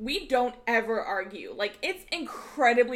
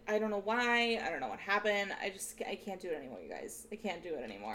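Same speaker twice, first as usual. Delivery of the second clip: trailing off softer and softer, defeated; mock despair; 3 semitones lower, 7 dB quieter, faster than usual. Complaining to cohosts about her watch list, fading into a defeated mumble. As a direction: give up on the sentence as it fades.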